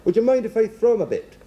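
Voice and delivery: high-pitched comic Irishman; put-on Irish accent. Irishman asking a woman about her poodle, comic Irish accent. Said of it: "That's not a bad accent".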